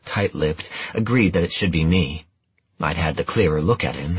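ordinary delivery

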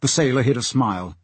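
plain reading voice

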